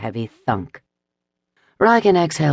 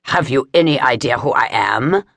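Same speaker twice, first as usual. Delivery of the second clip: arrogant challenge. Acted. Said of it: "arrogant despite his pain".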